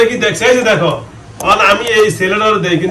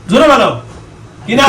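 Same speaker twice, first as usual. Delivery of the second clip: shouting; 5 semitones higher than usual. Real, not acted. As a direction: shout the order. Exorcist demanding louder answer.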